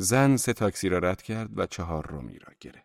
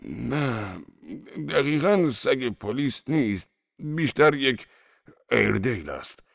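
slow, higher-pitched hopeless admission; disappointed; 4 semitones higher, slower than usual. A narrator's reading. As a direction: concede it slowly, in a let-down character voice. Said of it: "مرد با لحنی نومیدانه گفت".

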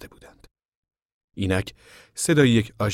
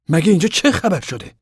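raised, high-pitched challenge; insistent demand; 11 semitones higher, 8 dB louder than usual. A narrator's reading. Lawman pressing Casey about the fallen deputy, insistent demand. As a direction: press loudly for an answer.

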